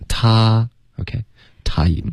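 slow "他" then "time", mouth wide on ah; model wide-open time. Shown as a demonstration. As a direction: demonstrate "time" opening on a big ah. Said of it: "time开头嘴巴再大一点点".

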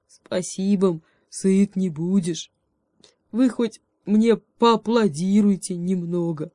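slow, lightly voiced bear; bear asking applause. A narrator's reading. Bear demanding applause, slow and light. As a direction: ask for applause with a grin.

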